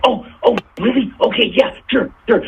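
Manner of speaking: mock starstruck agreement, high and quick to say yes; eager surprise. George hears a London magazine wants an interview, surprised and eager. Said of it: "And he's like".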